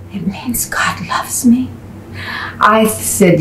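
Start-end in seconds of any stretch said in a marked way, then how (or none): 0.1-1.7 softly whispered wonder; whispering like a precious secret; whispered awe. Retold or imitated. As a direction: breathe it out in quiet awe. Healed woman's tender reply.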